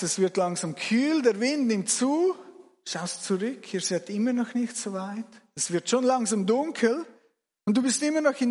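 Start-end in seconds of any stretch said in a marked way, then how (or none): none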